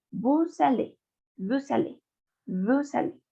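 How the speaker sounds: "vous allez" joined as voo-za-lay; "vous allez" run together as one word; French liaison. Shown as a demonstration.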